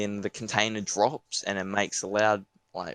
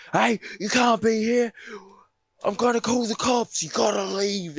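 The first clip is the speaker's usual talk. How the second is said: angry local yelling; 12 semitones higher than usual. Retold or imitated. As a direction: shout them off the property. Angry local ordering them off.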